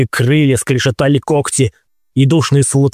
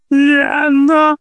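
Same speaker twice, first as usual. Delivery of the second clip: very high desperate scream; screaming a name. A narrator's reading. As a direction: cry out her name in panic. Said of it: "Завопил я".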